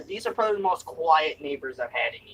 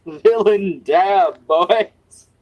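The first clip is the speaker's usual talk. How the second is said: slow, loud villain line through laughter; hammed-up villain roar; laughing villain shout; 3 semitones higher, 9 dB louder, much slower than usual. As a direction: announce it like a cackling villain. Louder laughing villain take.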